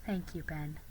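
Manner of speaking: quiet tender thank you; soft thanks. Acted. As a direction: thank him gently, almost hushed.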